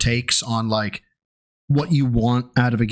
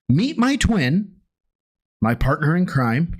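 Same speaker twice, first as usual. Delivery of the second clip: mock explaining. Shown as a demonstration. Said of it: "break this down for you".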